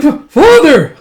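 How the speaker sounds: high, loud, stammered mock scream; horrified cry. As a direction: cry out in acted horror. Child discovers father as statue.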